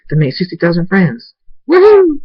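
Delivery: high and loud, ending in a whooping squeal; excited cheer. Acted. Abel running to Thundy, whooping with joy. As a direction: cheer like you just won.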